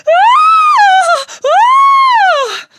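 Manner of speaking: piercing high scream; shriek. Acted. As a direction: scream in terror at the creepy crawly. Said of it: "she shrieked".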